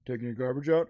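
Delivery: lightly voiced low neighbor; a hint of a deadpan, needling neighbor; passive-aggressive neighbor. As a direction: drop low for the neighbor's question. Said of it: "he does this passive aggressive thing".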